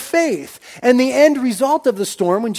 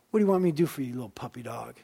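soft, tender voice, as to a little puppy; tender, fond; 8 semitones lower, 11 dB quieter, faster than usual. Acted. Jesus speaking softly and fondly to the woman, tender. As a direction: hush it down and coo it fondly. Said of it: "Can't you hear the Lord speaking like that?".

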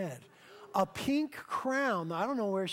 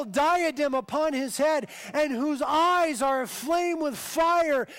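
loud, high, slow proclamation; booming pulpit climax; full-force preaching; 4 semitones higher, 5 dB louder, slower than usual. Acted. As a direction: preach it out to the back row.

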